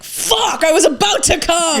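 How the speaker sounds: high mock-anguished whine; exasperated outburst without real volume; retold frustration. Complaining to the friend who came back, mock frustration.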